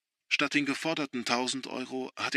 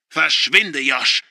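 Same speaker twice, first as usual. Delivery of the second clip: loud, higher command; furious order; 3 semitones higher, 11 dB louder, much slower than usual. A narrator's reading. Father ordering son out, loud. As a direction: throw him out with your voice.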